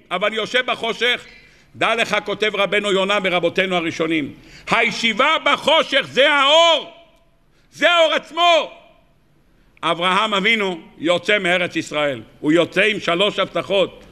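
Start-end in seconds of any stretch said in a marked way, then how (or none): 4.7-8.7 preaching climax. Real, not acted. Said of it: "preaching climax".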